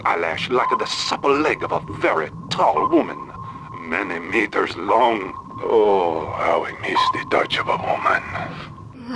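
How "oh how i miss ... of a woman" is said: lonely sigh. Acted. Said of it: "He sounds lonely".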